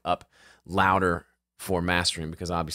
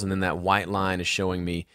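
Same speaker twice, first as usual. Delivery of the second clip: ordinary talk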